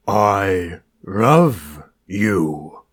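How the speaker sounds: very slow, laboured collie speech; horrific talking dog. A narrator's reading. Collie trying to mimic the landlord's voice, horrific. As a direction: stretch each word grotesquely, like a dog forcing speech. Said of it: "slowly pronounce, in a horrific voice".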